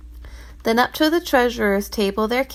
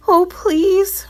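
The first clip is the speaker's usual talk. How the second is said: much higher, desperate Oh please; pleading cry; 10 semitones higher, 4 dB louder than usual. Acted. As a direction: cry out begging. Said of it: "cried the poor man".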